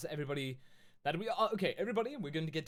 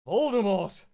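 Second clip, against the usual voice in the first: much louder, higher gasped name; dramatic reveal; 7 semitones higher, 13 dB louder, slower than usual. Acted. Narrator revealing Voldemort in the lair, mock dramatic. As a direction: announce the villain with shock.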